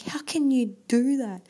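put-on high, indignant teen pleading; worked-up daughter. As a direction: mimic the upset daughter's disbelief. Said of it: "she's getting all worked up".

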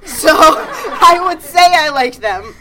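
giggling through the words; laughing. Real, not acted. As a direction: laugh it out, amused. Laughing after dildo-in-pants joke.

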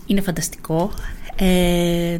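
ordinary delivery

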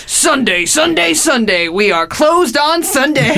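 high, slow booming ad voice; monster truck ad. Retold or imitated. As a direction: boom it like a rally promo. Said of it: "the whole bit was just me going".

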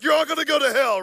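high, loud preacher's warning over music; mock hellfire shout; fire-and-brimstone preacher. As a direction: thunder it at the teens like a camp preacher. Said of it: "and he's like".

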